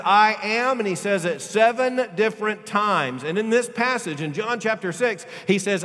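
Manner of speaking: loud, slow, soaring preacher's delivery; full-force preaching. Acted. Preacher to his congregation, full-force preaching. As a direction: preach it out with fire.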